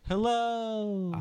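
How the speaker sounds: high, drawn-out call at an everyday volume; half-hearted high holler into a cave; mock yell. Player demonstrating a yell into the cave, high mock holler. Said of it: "and yell into the cave".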